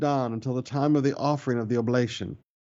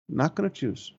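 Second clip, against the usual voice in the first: softer, sulky refusal voice; mock stubborn mumble; 3 dB quieter than usual. Preacher mimics people who will not choose, sulky and soft. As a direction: lightly mimic a stubborn holdout. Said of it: "I watch people act like this".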